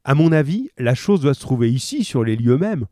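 ordinary delivery

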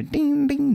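high-pitched sung "ding" like a bell; imitated ding. Host describing the little sound behind the beat, high imitated ding. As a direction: mimic a tinkling sample, far above the speaking voice.

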